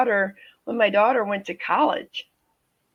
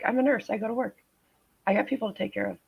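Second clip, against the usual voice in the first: ordinary talk, nothing marked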